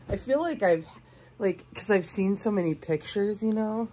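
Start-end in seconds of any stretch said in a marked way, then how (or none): none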